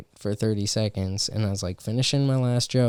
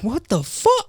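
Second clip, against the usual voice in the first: much higher disbelieving cry; incredulous; 12 semitones higher than usual. Retold or imitated. Crowd of friends, incredulous. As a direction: everyone stunned at once. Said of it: "everybody was like".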